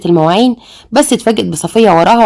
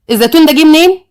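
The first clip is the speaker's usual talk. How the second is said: raised outraged surprise; shocked question; 7 semitones higher than usual. Acted. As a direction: demand it in disbelief. Daughter shocked at olive plate.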